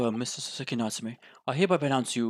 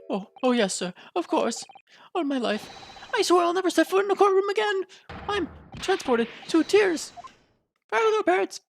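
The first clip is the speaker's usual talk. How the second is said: higher, eager, grateful little man; Natsume voice. Acted. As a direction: gush your thanks to the judge, voice climbing high.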